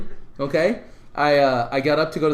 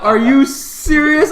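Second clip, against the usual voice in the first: slow, high, laughing disbelief; hearing he's famous, slow and laughing; 11 semitones higher, 10 dB louder, much slower than usual. Retold or imitated. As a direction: drag it out amazed. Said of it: "I was like".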